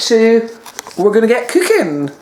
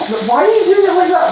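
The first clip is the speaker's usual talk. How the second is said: incredulous; 10 semitones higher, faster than usual. Real, not acted. Partner grating wrong.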